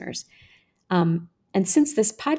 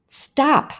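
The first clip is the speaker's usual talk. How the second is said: higher, pleading cry; 7 semitones higher, 3 dB louder than usual. A narrator's reading. Girl held down by the boys, pleading. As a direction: plead to be let go. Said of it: "I pleaded".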